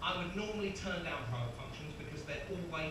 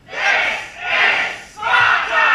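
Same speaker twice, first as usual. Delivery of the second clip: long drawn-out, high roar; huge Scottish battle yell; angry Scottish shout; 19 dB louder, much slower than usual. Acted. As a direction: bellow it slowly at full volume. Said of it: "in your best, angriest Scottish voice".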